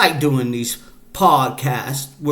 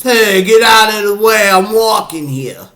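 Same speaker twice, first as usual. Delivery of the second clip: loud, higher mock New Yorker shout; New York accent; 5 semitones higher, 5 dB louder than usual. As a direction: yell like a brash New York pedestrian. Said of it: "New Yorkers".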